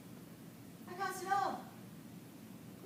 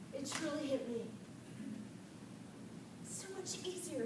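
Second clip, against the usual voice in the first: ordinary talk, nothing marked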